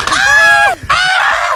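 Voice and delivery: high terrified screams; panicked scream. Acted. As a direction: scream as the brakes fail. Jeep loses its brakes.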